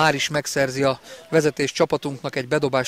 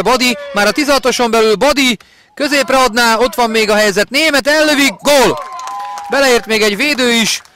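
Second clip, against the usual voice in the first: higher, louder building goal call; excited hungarian sports commentary; 9 semitones higher, 6 dB louder than usual. Real, not acted. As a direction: build the attack, burst on the goal.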